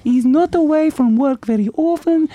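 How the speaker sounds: high, scolding Russian wife; Russian Mrs Claus. Acted. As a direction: nag your husband in Russian accent.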